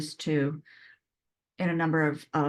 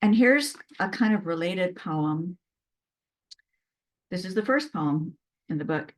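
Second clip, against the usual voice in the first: usual speaking voice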